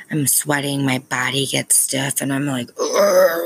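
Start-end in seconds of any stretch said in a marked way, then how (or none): 2.8-3.4 loud strained groan of frustration; tantrum groan. Retold or imitated. Mom reenacting her adult tantrum to viewers, loud groan. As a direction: groan like the body won't cooperate. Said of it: "the adult tantrums are like".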